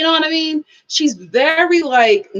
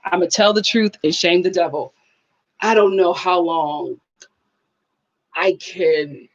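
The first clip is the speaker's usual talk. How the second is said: ordinary talk, nothing marked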